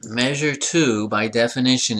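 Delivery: ordinary talk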